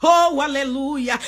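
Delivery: ordinary delivery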